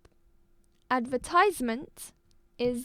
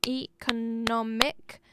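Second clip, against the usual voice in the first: economic slow and drawn out; syllable by syllable; slower than usual. Shown as a demonstration. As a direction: slowly spell out the beats of economic. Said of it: "four-syllable noun".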